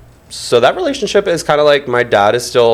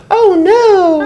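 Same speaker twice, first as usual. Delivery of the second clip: dramatic mock dismay; 4 dB louder than usual. Mock gasp over broken furniture.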